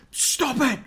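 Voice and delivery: high, squealed mock-furious shout; so mad. Imitating Bronson raging at kids, high hammed-up tantrum. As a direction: shriek it like a tantruming boy. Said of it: "He gets so mad".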